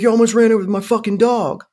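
dog owner shouting at the driver, angry. Retold or imitated. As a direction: throw it back at him like he did. Hiker yells about his dog.

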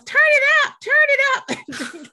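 high, loud mock yell; imitated yell. Performer at the party to the sound system, yelling. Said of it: "she just yells".

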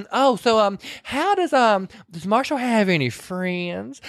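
a nosy Southern lady fishing for gossip; voicing a gossiping lady.

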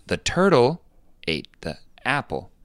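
TURTLE high and loud, then a drop on "ate the apple"; intonation demo. Shown as a demonstration. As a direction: punch "turtle" high, pause, let the rest fall. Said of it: "Do you notice that intonation?".

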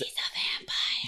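slow whispered secret; whispering. Real, not acted. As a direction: let them in on a secret. Conspiratorial aside about vampire.

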